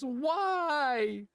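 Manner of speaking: high, drawn-out mock wail; imitated child's crying. Dad mimics his son crying after being scolded, plaintive wail. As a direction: imitate a little kid's tearful whine. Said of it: "when he cries, he goes".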